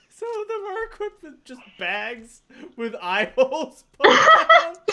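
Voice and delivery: laughing through the words; giggling while talking. Real, not acted. Laughing while describing masks.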